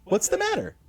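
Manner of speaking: high, upbeat encouraging voice; cheerful carpenter reassuring; customer service voice. Acted. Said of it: "my customer service voice".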